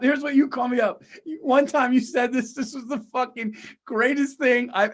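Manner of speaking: ordinary talk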